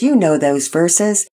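plain reading voice

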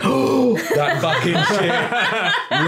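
high, slow horrified gasp; sudden realisation. Retold or imitated. Game dev remembering his ship mid tea, high slow horrified realisation. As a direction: stretch it out as it dawns on you.

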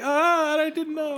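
high-pitched helpless protest; hammed-up embarrassed yelp; mock flustered. Acting out being confronted over seat.

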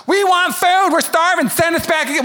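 high, loud whining crowd; whiny complaining. Retold or imitated. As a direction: whine like hungry grumblers. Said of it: "the Israelites are complaining".

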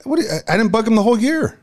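high-pitched indignant defense; real high-pitched protest; defensive protest. Real, not acted. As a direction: protest the accusation, voice jumping up. Defending himself against bugging accusation.

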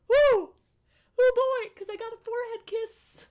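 high thrilled fangirl squeal; excited squeal. Real, not acted. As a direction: squeal with delight. Excited fangirl squeal over scene.

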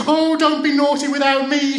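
put-on high, loud childish wail; acted wailing plea; crying out. A narrator's reading. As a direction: cry out in protest as a little girl. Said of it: "she cried".

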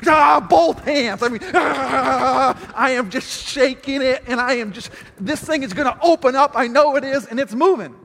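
a worked-up, straining retelling of yanking the lock; straining effort. Retold or imitated. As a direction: push with everything, gasping with effort. Shaking the locked door hard.

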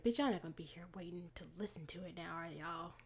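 hushed fast whisper. Retold or imitated. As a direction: whisper it under your breath. Mocking courtiers at bedtime.

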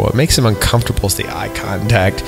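normal talking voice

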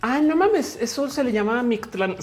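high, mock-amazed exclamation; retold surprise. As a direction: act out the discovery, amazed. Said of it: "que yo digo".

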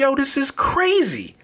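high-pitched, incredulous protest; mock disbelief. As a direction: act out stunned disbelief, pitch way up. Acted outburst of disbelief in retelling.

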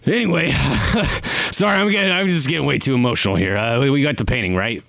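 flustered, over-emotional character. Acted. Client embarrassed at getting too emotional, flustered and high. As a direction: hurriedly brush away your emotion with an awkward laugh.

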